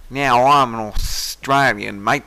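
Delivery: put-on slow, broad Australian accent. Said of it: "But here goes the Australian one".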